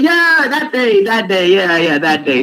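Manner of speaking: high, slow drawn-out yeah, that day; an exaggerated eager nod in voice; mock eager agreement. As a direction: play it knowingly eager, stretching the first yeah. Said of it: "I'm like".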